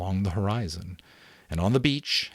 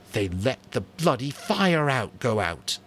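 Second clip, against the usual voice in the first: bitter; 5 semitones higher, slower than usual. A narrator's reading. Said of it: "the worst word he knew".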